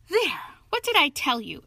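loud, high exclamation; ringing, triumphant outburst. A narrator's reading. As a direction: crow over being proved right. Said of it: "in a great triumph".

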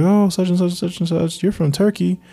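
high, playful third-grader teasing a friend; joking kid voice. Retold or imitated. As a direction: tease like a little kid at lunch. Kid self joking at lunch.

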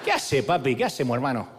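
put-on Argentine accent. The donkey answers Balaam in a mock Argentine accent. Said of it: "distinguido acento argentino".

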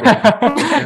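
slow, loud, high laugh-through words. Real, not acted. Creator cracking up as he concedes the fan theory. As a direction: give in to a laugh mid-sentence.

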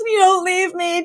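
high hammed-up sob of a plea; imitating a melodramatic widow's cry; mock wailing plea. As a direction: throw yourself on him, overacting grief. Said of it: "jumps on the gurney and goes".

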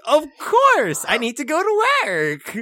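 high, bright eager voice; mock cheerful commuter. Craig eagerly answering the train conductor, high. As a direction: chirp it like a happy commuter. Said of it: "And he's like".

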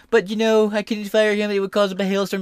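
high, put-on whiny Trump voice; mock Trump excuse. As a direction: parody him making excuses. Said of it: "Trump couldn't fire back".

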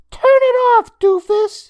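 put-on high, loud boyish voice hushed in tension; strained shout. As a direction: yell at her but keep it down. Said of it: "he shouted with a strained whisper".

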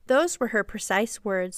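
ordinary delivery

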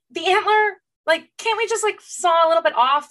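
exasperated pleading. Real, not acted. Pleading about killing the stag.

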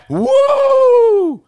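high sarcastic woo; sarcastic whoop; mock cheer.

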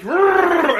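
loud, high mock "Rrrr"; exaggerated pirate noise; pirate growl. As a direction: imitate a hammed-up pirate roar. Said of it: "he's not like".

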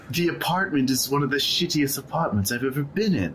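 high exasperated protest. Acted. Roommate refusing to redecorate a bad apartment, exasperated.

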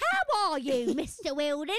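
loud, high, hammed-up Cockney greeting; Cockney accent. Greeting the officer for a laugh, hammed-up Cockney. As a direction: belt it out in mock Cockney. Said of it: "had to be done in a Cockney accent".

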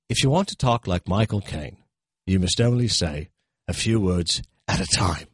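lightly voiced Michael Caine; Michael Caine impression. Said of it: "this Michael Caine impression".

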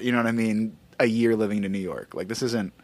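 ordinary delivery

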